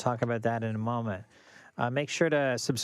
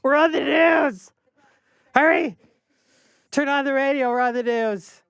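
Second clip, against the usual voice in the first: much higher, louder joyful shout; excited shouting; 14 semitones higher, 8 dB louder than usual. Real, not acted. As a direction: yell it out, thrilled. Hears themselves on the radio.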